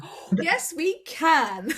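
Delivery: high, loud mock-confident recovery; styling it out. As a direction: cover a slip with showy assurance. Acting out styling out her slip.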